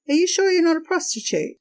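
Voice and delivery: nosy driver pushing his suspicion; pushy disbelief. Retold or imitated. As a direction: needle her with the question, quick and high. Driver presses the mother again.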